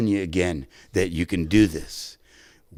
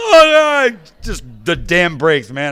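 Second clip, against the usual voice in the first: higher and louder, breaking into laughing sobs; laugh-crying; 12 semitones higher, 8 dB louder than usual. Retold or imitated. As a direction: let the dam break mid-laugh. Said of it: "I'm just boo-hooing".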